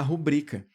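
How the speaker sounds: "rubrica" with the weight on BRI; ru-BRI-ca, stressing the second syllable of "rubrica"; stress on middle syllable. Shown as a demonstration. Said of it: "no bri". ("rubrica" com força no bri)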